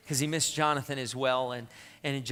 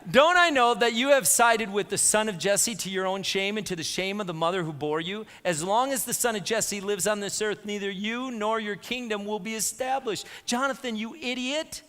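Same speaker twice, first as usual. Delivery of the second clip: high, heated scolding of a son; raised-pitch, indignant rebuke from an angry father; angry king; 6 semitones higher, 5 dB louder than usual. Acted. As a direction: berate him in acted fury. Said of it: "Saul's anger flared up at Jonathan".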